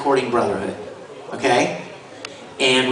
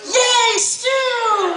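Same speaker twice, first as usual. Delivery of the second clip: put-on high, excited kid cheer. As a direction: squeal it like young Al. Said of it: "Al turns around and goes".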